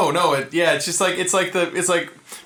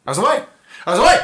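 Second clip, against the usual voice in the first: louder and higher each time; rising shout; 8 semitones higher, 9 dB louder, much faster than usual. Pretend. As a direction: build to a yell. Building to a shout.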